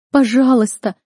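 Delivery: high, loud desperate plea; crying out. A narrator's reading. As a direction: beg at the top of emotion. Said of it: "кричала я".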